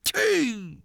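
high, piping spit sound; spitting noise. A narrator's reading. Odd thinker spits out a cherry stone, high-pitched. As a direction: mimic spitting out a pip with a high toot. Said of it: "kiköpött egy cseresznyemagot".

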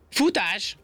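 high, sharp command to run; commanding cry. Acted. Rabbit orders the ant to flee, high command. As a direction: order the escape at once. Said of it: "vezényelt a nyúl".

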